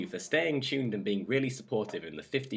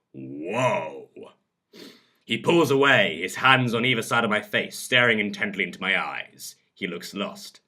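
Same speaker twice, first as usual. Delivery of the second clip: dramatic audiobook reading; measured, expressive storyteller; story narration; 6 semitones lower than usual. A narrator's reading. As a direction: read an intimate romance scene aloud. Reads a story aloud.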